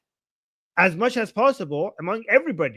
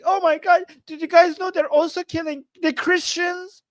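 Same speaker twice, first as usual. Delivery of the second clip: mocking pundits; 9 semitones higher than usual. Mocking Tucker Carlson and Anna Kasparian, hammed-up mock shock. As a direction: parody a breathless pundit's shocked discovery. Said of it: "were like".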